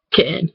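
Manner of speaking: "kitten" as KIH'-in; glottal stop. Shown as a demonstration. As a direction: catch the voice in place of the tt in kitten. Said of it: "like, a glottal stop".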